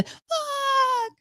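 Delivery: high thin muttered curse; high quiet curse. Retold or imitated. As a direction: squeak it out under your breath. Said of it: "Just a".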